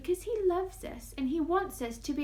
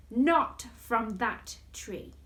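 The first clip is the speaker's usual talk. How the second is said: slow warning; 3 dB louder, much slower than usual. Acted. Acting out God's warning.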